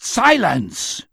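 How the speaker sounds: loud, high roar of command. A narrator's reading. Old man silencing his heckler, roared. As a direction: cut them off with a roar. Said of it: "Chaim roared".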